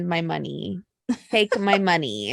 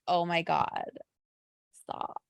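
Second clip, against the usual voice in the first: soft, fading, overwhelmed plea to stop; a fan begging the emotional speech to stop; pained quiet plea; 3 semitones lower, 7 dB quieter than usual. Retold or imitated. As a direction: let it drop to almost nothing, like it hurts to listen. Moved to tears by speech.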